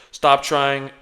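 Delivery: ordinary talk, nothing marked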